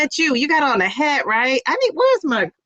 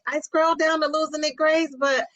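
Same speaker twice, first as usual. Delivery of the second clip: ordinary talk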